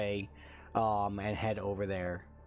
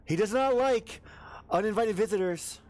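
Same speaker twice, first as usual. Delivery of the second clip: pitched way up and loud; alarmed barkeep warning; 9 semitones higher, 4 dB louder than usual. Acted. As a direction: warn off an intruder in a high, worried voice. Barkeep warns intruder off.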